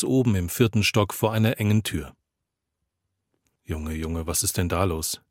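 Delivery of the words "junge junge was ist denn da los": low, hushed question to himself; asking himself. A narrator's reading. Narrator puzzled at the noisy door, asking himself quietly. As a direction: wonder under your breath, low and soft. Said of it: "frage ich mich selbst".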